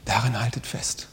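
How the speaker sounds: hushed exhortation; whisper. Acted. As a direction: whisper the encouragement.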